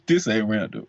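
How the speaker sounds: chuckling through the words; laughing. Real, not acted. Joking to the cohost that he wasn't like this, laughing. Laughing about his past self.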